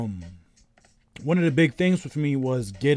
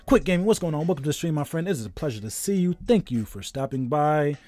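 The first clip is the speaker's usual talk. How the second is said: streamer greeting; 4 semitones higher than usual. Acted. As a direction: welcome the viewer like a show host. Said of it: "I really enjoy saying that".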